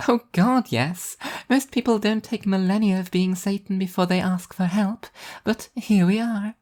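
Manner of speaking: high, bright cheerful angel voice; an upbeat angel showing off her plan; high, cheerful divine woman; cheerful angel Arbitrage. A narrator's reading.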